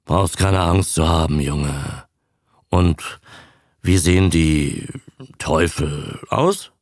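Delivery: calm him down in a low voice, with pauses; reassuring captain. A narrator's reading. Captain to his frightened young diver, low and reassuring.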